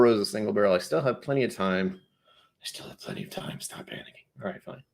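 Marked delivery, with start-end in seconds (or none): 2.6-3.6 hushed, voiceless aside; whispered self-reassurance. Real, not acted. Streamer reassuring himself about finishing the drink, whispered. 3.6-4.8 very soft whisper to yourself; hushed, voiceless aside; whispered self-scolding. Real, not acted. Streamer telling himself not to panic, whispered.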